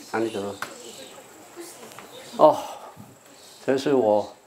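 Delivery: ordinary talk, nothing marked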